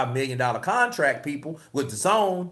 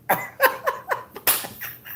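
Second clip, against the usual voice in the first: high wheezing laugh. Real, not acted. Laughing at own impression.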